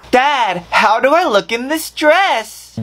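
ordinary talk, nothing marked

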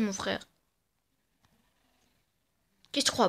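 usual speaking voice

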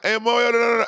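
high, garbled mock holler; mocking the wrong imitation. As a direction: parody the bad impression. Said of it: "it's not".

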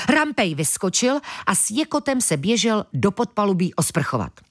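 ordinary delivery, nothing marked

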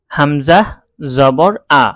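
teacher drilling Arabic spelling. Shown as a demonstration. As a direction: spell হামজা জবর আ slowly for students. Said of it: "এভাবে আমরা বানান করব".

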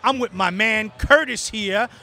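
ordinary talk

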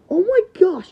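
high, loud gasped exclamation; shocked cry. Acted. Friend finds Valtryek broken, loud shocked cry.

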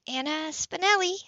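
crisp businesswoman answering her line; a lightly voiced woman on the phone; professional phone greeting. A narrator's reading. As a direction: answer like a neutral office professional. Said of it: "Her voice was low, professionally neutral".

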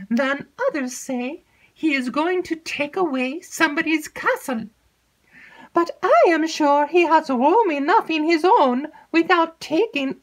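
put-on high, breathless chatter of an excitable young maid; alarmed lady's maid spilling gossip. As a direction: gabble it like a nervous servant girl. Maid Annette gossips to her lady.

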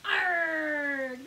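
loud pirate shout; pirate arg. Shown as a demonstration. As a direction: bellow it like a pirate. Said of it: "Can you say "arg" like a pirate?".

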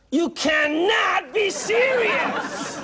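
high, drawn-out cry of disbelief; hammed-up outrage, stretching every word for laughs; mock-outraged shout. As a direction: play utterly incredulous, slow and very high. Host mocks contestant's sportswear job.

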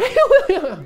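loud, high-pitched flustered outburst; flustered blurt. Acted. Presenter fails to ask how to announce her, flustered blurt. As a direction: blurt it out high, barely getting it out. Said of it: "in de situatie, ik kom ook niet verder dan".

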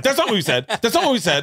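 high-pitched imitation of protesting daughters; mock indignant protest. Daughters' indignant denial acted in argument.

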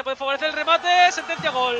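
building excitement. Real, not acted. Build-up to a goal.

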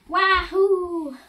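playful Luigi-style wahoo; excited cheer. Pretend. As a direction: belt out a triumphant wahoo.

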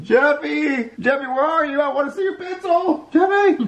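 high, searching call. Acted. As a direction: call for a lost child, pitch climbing. Searching house for lost child.

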